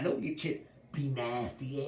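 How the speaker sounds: usual speaking voice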